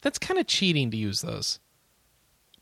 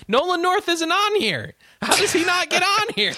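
mock outrage; 4 dB louder than usual. Mock outrage over missing nominee.

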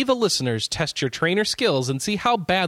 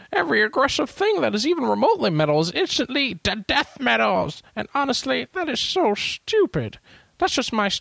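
put-on old-timey voice; 9 semitones higher than usual. Shown as a demonstration. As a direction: read it like an old-time radio host. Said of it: "I'm gonna read 'em in an old-timey voice".

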